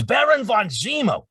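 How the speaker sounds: imitating an old man's curious question; elderly Stan Lee voice. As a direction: play the old comics legend asking. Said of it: "He says".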